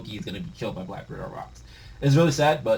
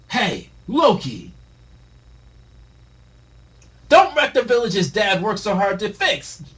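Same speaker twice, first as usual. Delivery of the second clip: slow, high, loud protest; calling out; 9 semitones higher, 7 dB louder, slower than usual. Acted. Young Harold shouting at Loki to stop, pleading yell. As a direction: yell it up at the giant.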